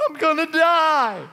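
high, slow, terrified "I'm gonna die"; panicked inner voice. Pretend. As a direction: voice the fear inside you as you board. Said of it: "everything in you goes".

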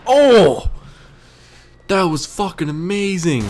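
very high, loud whoop of amazement; amazed yell. Real, not acted. Gamer reacting to his own amazing shot, amazed yell. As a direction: cry out, thrilled by your own feat.

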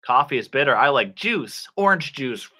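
ordinary delivery

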